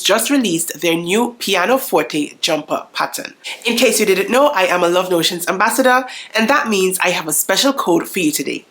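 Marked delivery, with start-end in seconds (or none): none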